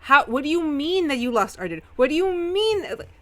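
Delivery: loud, high, incredulous tirade; angry yelling. Acted. Samuel berating Eamon for losing Arden, furious yelling. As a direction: yell it in outrage, voice climbing. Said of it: "pointing and yelling".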